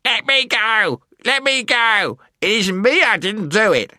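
very high, frantic little creature voice; high-pitched panicked protest; squealing gnome. A narrator's reading. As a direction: squeal and plead as a captured gnome. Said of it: "squealed the Earthman".

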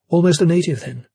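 character voice. Acted. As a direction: lift the pitch and tease gently. Jane teases lightly.

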